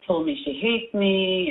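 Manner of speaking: usual speaking voice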